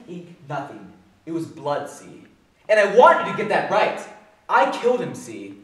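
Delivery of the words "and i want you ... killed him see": loud, punched-out insistence; insistent, agitated confession. Acted. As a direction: hammer every word at the whole jury. Defiant confession, counts off the jury.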